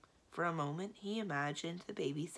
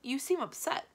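higher-pitched, concerned remark; anxious; 9 semitones higher, 4 dB louder than usual. A narrator's reading. Secretary observes his master's upset, anxious. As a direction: venture it with mild worry. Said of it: "he asked anxiously".

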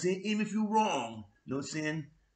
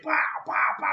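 mock punch sounds; 9 semitones higher, much faster than usual. Imitating fight blows.

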